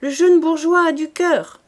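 a high, loud shouted request; calling out. Acted. Cantinière to corporal Aubry, high loud call.

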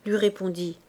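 ordinary delivery, nothing marked

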